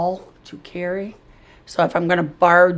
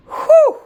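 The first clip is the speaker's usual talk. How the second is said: big whew; 9 dB louder than usual. Real, not acted. Relief after a hard lesson.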